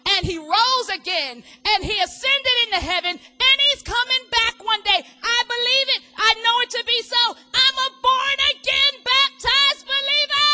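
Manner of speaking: chanting confession of faith; testifying believer. Retold or imitated. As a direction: proclaim your faith, climbing high. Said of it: "reciting believer's confession".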